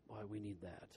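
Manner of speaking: ordinary talk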